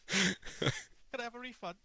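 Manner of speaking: high, loud mocking whine as a fake victim; mock scam victim. Friend mocks the Steam gamer's plea, high mock whine. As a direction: squeal it like a helpless scammed customer.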